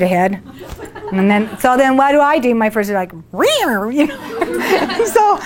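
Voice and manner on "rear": playful laughing "rear" growl; mock growl. Showing her first annoyed urge, a laughing mock growl. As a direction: growl like a cross cat, half laughing.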